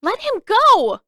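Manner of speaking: high, loud desperate cry; cried out. A narrator's reading. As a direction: scream at the beast to release him. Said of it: "she cried".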